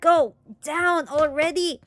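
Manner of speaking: high, loud, drawn-out yell; frustrated shout. Acted. Frustrated at the clogged sink, shouting. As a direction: shout it slowly, word by word. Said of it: "then shouting".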